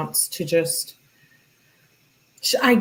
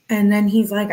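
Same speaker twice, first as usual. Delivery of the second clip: ordinary talk, nothing marked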